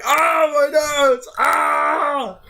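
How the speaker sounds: high, slow hammed-up wail; mock pained cry. Thug wails after a kick to the nose. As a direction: cry out over a broken nose. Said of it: "one of the guys like".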